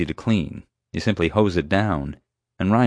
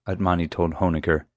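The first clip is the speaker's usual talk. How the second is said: ordinary delivery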